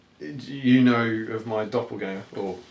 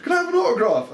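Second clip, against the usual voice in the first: put-on higher, louder eager kid's voice; imitating a kid; 12 semitones higher, 5 dB louder than usual. A kid asking the lookalike for an autograph, eager. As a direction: lightly voice a hopeful child. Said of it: "one of these kids went".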